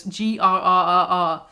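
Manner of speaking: slow mock growl, spelled out; growling grr. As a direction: growl each letter slowly. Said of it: "writing the words grr".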